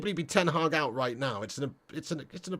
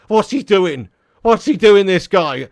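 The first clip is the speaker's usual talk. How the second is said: louder, incredulous manager rant, hammed up; mock manager yell; 3 semitones higher, 12 dB louder, slower than usual. Mocking Ten Hag slamming his new signing, shouted. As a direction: bellow it like a fed-up boss. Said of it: "He's not gonna say".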